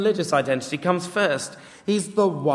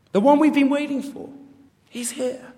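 awed excitement; 11 semitones higher than usual. Acted. Philip announcing the long-expected somebody, excited. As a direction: announce it in a high, marvelling voice.

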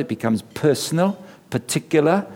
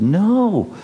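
a startled no, pitched up; shocked no; 7 semitones higher than usual. Retold or imitated. Preacher reacting to the schoolboy's confession, shocked.

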